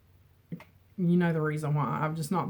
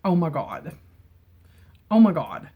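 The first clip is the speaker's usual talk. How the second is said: surprised; 6 dB louder than usual. Real, not acted. Opening a surprise gift.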